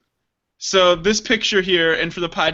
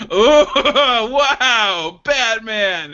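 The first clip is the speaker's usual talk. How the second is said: mock awe; 4 semitones higher, 4 dB louder, much slower than usual.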